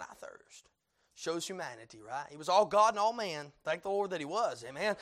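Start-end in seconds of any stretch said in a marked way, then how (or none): 0.0-0.7 parched whisper; whispered thirst. Acted. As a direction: breathe it out dry and spent. Voicing Christ's dying thirst.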